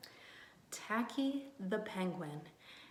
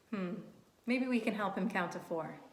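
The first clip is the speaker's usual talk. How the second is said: ordinary delivery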